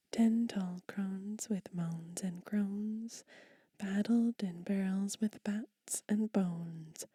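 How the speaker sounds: rhythmic whispered poem, slow and emphatic; poem reading. Acted. Whispered children's poem read aloud to ASMR viewers. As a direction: read the rhyme with a bouncing beat.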